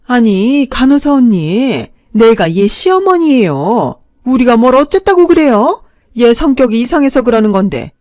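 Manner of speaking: loud, high indignant protest; mother-in-law protesting to the nurse, loud and indignant. Acted.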